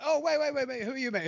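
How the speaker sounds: high, loud mock alarm at an intruder; imitating a startled flatmate. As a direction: stop him, startled and high. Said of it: "and we're like".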